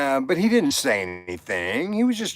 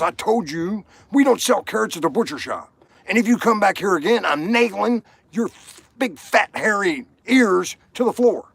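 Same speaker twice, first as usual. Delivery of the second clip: mock angry butcher; 7 semitones higher than usual. Butcher in a told joke.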